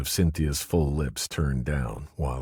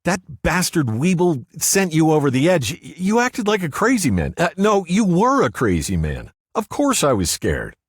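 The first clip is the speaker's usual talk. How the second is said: much higher and louder, agitated; woman's voice, rattled; Cynthia, upset; 9 dB louder than usual. A narrator's reading. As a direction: blurt it out, then confess fear.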